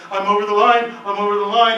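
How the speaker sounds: put-on kid taunting. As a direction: tease like a kid in the back seat. Kids squabbling in the car.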